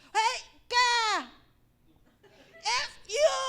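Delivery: very high, loud mock yell into a mic; drunk karaoke yelling. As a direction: bellow it like a rowdy singer. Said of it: "Nagkakaraoke sila". (malakas na sigaw sa karaoke)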